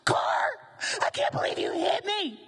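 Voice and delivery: high-pitched, acted angry rant; mock screaming. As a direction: rail at the car in hammed-up outrage. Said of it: "you start screaming at the car".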